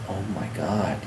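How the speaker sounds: soft, low and shaken by the moment; barely voiced disbelief; stunned hush. Father seeing his grown daughter, stunned and hushed.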